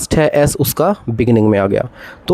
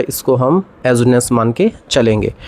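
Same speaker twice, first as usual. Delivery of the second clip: ordinary talk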